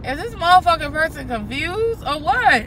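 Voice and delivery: yelling at a bad driver; annoyed shout at driver. Real, not acted.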